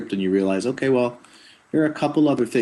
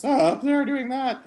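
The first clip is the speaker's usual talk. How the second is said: high character voice; Atlas voice. Shown as a demonstration. Voice actor sampling another character in a high voice. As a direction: jump up into the high register. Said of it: "But then if Atlas".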